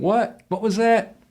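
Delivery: high, puzzled "What? What was that?"; mock confused asker. Mock confused "What?" to a caller on a bad line. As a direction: play someone who can't hear you. Imitating a listener who mishears.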